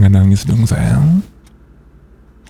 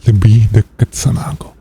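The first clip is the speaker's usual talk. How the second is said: usual speaking voice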